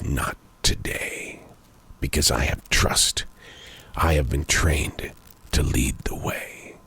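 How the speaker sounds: very low, hushed, slow resolve; lighthouse speaking. Acted. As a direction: sink to a slow near-whisper, a lighthouse standing firm. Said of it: "the lighthouse says".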